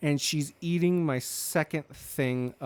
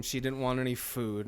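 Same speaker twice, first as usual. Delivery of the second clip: ordinary delivery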